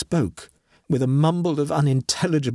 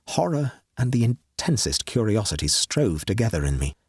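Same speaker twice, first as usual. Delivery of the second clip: ordinary delivery, nothing marked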